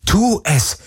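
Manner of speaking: high-pitched, urgent inner cry; inner scream. A narrator's reading. Girl willing herself to act, inner scream. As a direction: urge yourself on desperately. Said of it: "schrie alles in ihr".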